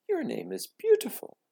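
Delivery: high, fond praise; reassuring. A narrator's reading. Mother reassuring her upset daughter, tender. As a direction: comfort her with it.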